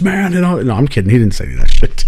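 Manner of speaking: ordinary delivery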